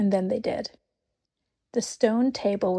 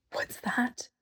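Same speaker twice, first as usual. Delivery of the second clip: hushed, scared whisper; frightened whisper; 3 semitones higher, 7 dB quieter than usual. A narrator's reading. Lucy whispers to Susan, frightened by the crack. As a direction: breathe it out in fear. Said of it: "clutching Susan's arm".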